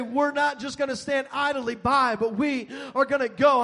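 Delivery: bold shouted declaration; declaring loudly. Acted. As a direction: call it out like a vow. Quoting the church's resolve.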